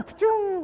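high burst imitating a Roman candle; firework pa-choom. Teen showing off fireworks to a date, high mock pa-choom. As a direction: spit out a high firework pop. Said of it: "firework sound".

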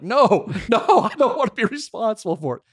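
high, laughing no, no; laughing refusal. Retold or imitated. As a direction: laugh your way through turning it down. Refusing the borrowed diamond necklace.

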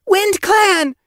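very high, loud urgent cry; gasped. A narrator's reading. Apprentice races back to warn the warriors, gasping. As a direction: blurt the alarm out, high. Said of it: "Yellow Paw gasped".

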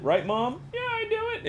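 very high, soft mom voice. Pretend. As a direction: answer as a mom in a high, breathy squeak. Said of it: "It just doesn't sound like that".

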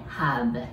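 "Have" with the lip bitten on the v; v sound demo. Shown as a demonstration. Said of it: "唇を噛んで".

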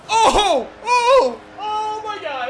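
loud, high whoops of real excitement; excited cheer. Real, not acted. Player thrilled by a beautiful play, excited cheer.

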